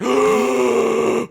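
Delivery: huge shocked gasp, high and loud. Pretend. Moviegoer gasping at a surprise reveal: sharp gasp.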